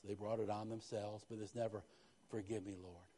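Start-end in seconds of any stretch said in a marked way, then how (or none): 2.3-3.1 quiet, pleading prayer; soft plea. Acted. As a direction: beg the Lord softly. Voicing sincere prayer.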